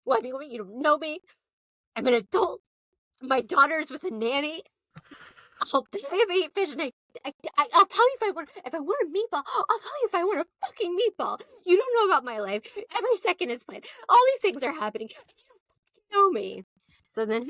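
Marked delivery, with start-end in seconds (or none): 0.0-4.6 high, mock-tearful whine; imitated crying Bethany. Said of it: "Bethany starts crying". 5.7-16.6 high, rushed mock-hysterical rant; imitated crying meltdown. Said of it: "Bethany starts crying".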